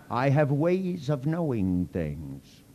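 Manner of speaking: slow, mock-sinister hint. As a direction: spell it out slowly like a mock villain.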